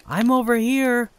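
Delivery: high, loud call across the house; yelling from afar. A narrator's reading. Brother calling to his sister from across the house, yelling. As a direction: shout so she hears you rooms away. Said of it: "I heard him yell".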